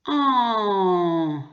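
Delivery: en held long and falling, one nasal vowel. Shown as a demonstration. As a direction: stretch en out high, with no N heard. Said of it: "On dit". (« en » nasal, longuement tenu)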